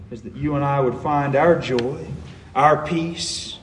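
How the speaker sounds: ordinary talk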